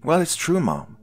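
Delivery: high, loud protest; insistent. A narrator's reading. Daughter defending her point to Mom, insistent. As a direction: insist it, pitch dropping at the end. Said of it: "she insisted".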